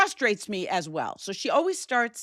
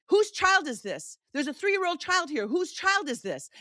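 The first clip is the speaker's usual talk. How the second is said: calling out; 8 semitones higher than usual. Retold or imitated. Calling out in a dark theater.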